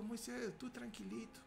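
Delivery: soft, hushed reassurance; calm him gently, almost whispered; voz suave de Dios. Acted. Dios calma a Moisés, suave. (Dios tranquilizando a Moisés en voz muy baja)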